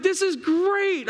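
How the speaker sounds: high, drawn-out delight; slow, high-pitched glee. Retold or imitated. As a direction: re-enact a thrilled dad. Retold delight at fearless son.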